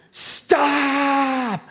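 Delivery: high, long-drawn cry of stop; urgent yelled warning. Acted. Preacher acting warning a child off the street, high drawn-out yell. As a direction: cry it out high, holding the vowel. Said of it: "And a loud voice".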